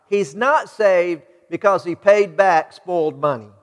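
ordinary delivery

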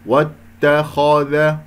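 "واتخذ" chanted slowly, heavy خ without lip-rounding; correct, unexaggerated. Shown as a demonstration. Teacher showing like this, slow even chant of "واتخذ". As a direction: recite "واتخذ" evenly, only the خ full. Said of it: "هكذا".

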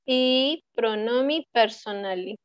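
"pronomi personali" lento, con cada letra audible; todas las letras pronunciadas. Shown as a demonstration. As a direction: articula despacio cada sonido de "pronomi personali". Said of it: "se pronuncian todas las letras". ("pronomi personali" pausado, sin comerse ninguna letra)

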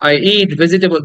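usual speaking voice

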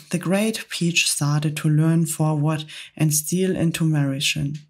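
ordinary delivery

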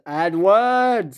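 drawn-out mock scream of the name. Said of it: "screamed Gordon".

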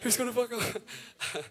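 mock panicked fiddler. Fiddle player fearing he'll mess up, mock panic. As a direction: play the fiddler terrified of fumbling. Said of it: "The fiddle guy".

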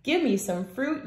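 plain reading voice

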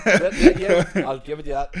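loud, high laughing concession; real laughter through the words. Real, not acted. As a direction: concede while cracking up. Laughing at remark about ten years.

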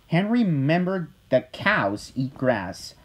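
usual speaking voice

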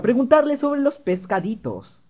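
a hint of an eager little kid; little boy voice. Little boy off to ask about the fishes, light child voice. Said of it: "de niñito".

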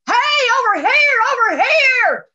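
high, loud calling across distance; acted yell. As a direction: holler to someone lost in the woods. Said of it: "He yelled".

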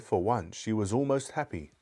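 ordinary delivery, nothing marked

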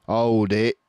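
firm halt; calling halt. Acted. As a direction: stop them sharply. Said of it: "he said".

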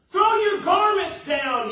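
loud, slow, cross demand; cross shout. A narrator's reading. Donkey crossly shouting at the crowd at the well. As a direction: bark it like a spoiled star. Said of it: "he said crossly".

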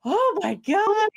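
high, drawn-out gasping disbelief; slow, high-pitched exclamation; mock dismay. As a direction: imitate an overwhelmed patient's reaction. Lydia reacts to the name.

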